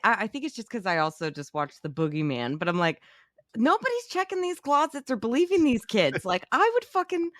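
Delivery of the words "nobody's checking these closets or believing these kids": high, exasperated rant at horror parents; mock exasperation. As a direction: throw up your hands, nobody listens. Mock outrage about horror movies.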